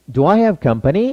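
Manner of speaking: slow, rising question tune on company; question rise. Shown as a demonstration. Teacher models slow rising question intonation. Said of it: "억양은 물어보는 말이니까 다 싹싹 올려주고".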